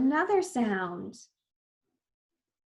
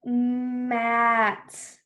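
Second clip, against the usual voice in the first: drawn-out blending. Shown as a demonstration. Teacher repeats the blend for children, Mat drawn out. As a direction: stretch every sound of Mat slowly.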